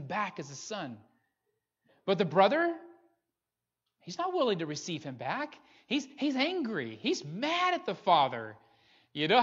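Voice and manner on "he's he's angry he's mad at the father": ordinary delivery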